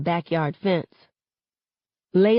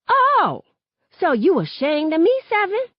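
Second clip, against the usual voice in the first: very high, loud stunned outrage; wounded fury; 13 semitones higher, 5 dB louder than usual. Acted. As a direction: shriek it, stung and furious. Aisha hurt and furious.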